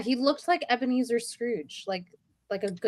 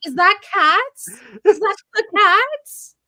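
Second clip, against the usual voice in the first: surprised; 7 semitones higher, 11 dB louder than usual. Retold or imitated. Retelling her reaction to the scene.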